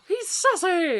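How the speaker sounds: loud, high, drawn-out squeal; gasp, then exclaim it high and slow; delighted exclamation. Real, not acted. Delighted gasp at character description.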